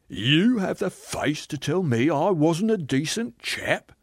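outraged. Acted. Ghost told he wasn't decent, outraged. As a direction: stretch it out in affront.

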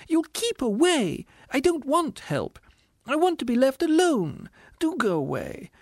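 very high indignant ghost voice; distressed squealing ghost. A narrator's reading. Ghost rebuking the spirit, squealing indignantly. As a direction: plead indignantly, far up high.

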